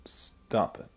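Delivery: urgent hushed whisper. Pretend. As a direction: hiss it softly to keep a secret. Pretending to hide elf identity.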